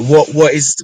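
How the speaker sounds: ordinary delivery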